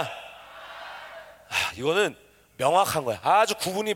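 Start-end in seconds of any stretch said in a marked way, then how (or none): none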